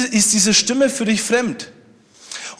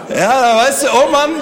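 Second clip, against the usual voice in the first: amused; 3 semitones higher, 4 dB louder than usual. Real, not acted. Still amused after joke.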